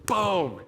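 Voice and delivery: loud, high, falling "boom"; imitated impact boom. Safety flattens him downhill, loud imitated boom. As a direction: punch out the crash of a big hit.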